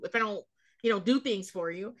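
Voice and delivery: ordinary talk, nothing marked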